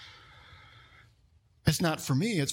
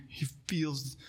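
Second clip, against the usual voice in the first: soft whisper; 3 semitones lower, 8 dB quieter than usual. Real, not acted. Tender aside on Paul's compassion.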